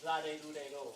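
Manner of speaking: "la de do de do" with R between L and D; Japanese R sounds. Shown as a demonstration. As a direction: recite "la de do de do" as even Japanese syllables. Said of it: "and D's are very, like, similar".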